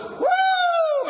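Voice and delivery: high loud whoop of joy; happy whoop. Retold or imitated. Preacher recalling his conversion, high loud whoop. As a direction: let it rip. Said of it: "I was so happy".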